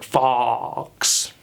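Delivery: FOX with a long open AW, like awe; British vowel. Shown as a demonstration. Accent teacher putting an F before awe, British vowel. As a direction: stretch FOX out slowly as "fawks". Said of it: "putting an F in front of it".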